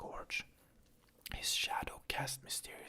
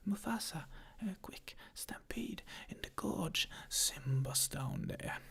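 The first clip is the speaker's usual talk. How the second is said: out of breath; 7 semitones lower, 6 dB louder, slower than usual. Acted. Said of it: "out of breath".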